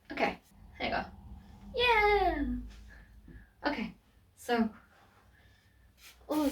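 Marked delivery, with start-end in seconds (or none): 1.8-2.4 excited cheer. Real, not acted. Celebrates transformation.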